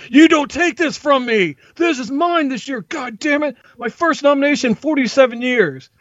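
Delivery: high-pitched, indignant mock yelling; ranting yell. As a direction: rant like an aggrieved patient at imaginary rivals. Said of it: "like, yelling at Legos".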